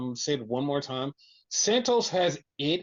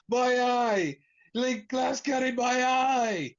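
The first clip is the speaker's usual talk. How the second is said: high, drawn-out imitated wail; mock pained cry; 7 semitones higher, slower than usual. Injured wrestler with glass in his eye, mock pained cry. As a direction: cry out in hammed-up pain, high and slow.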